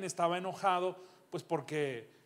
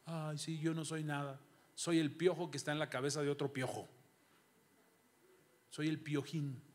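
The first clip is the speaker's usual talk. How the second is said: mock self-pity; 5 semitones lower, 6 dB quieter than usual. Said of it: "y al rato estoy ahí tirado llorando".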